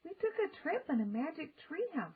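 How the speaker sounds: simply. A narrator's reading. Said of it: "said Annie simply".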